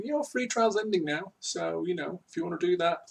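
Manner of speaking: high, soft, offhand little voice; lightly singsong, sheepish nudge; mock reminder email. Company reluctantly reminding a free trial customer, mock-meek.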